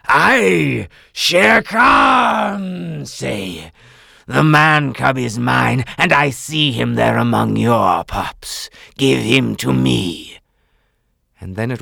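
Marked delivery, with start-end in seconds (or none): 0.1-10.4 slow, loud roaring tiger demand; Shere Khan shouting. Acted.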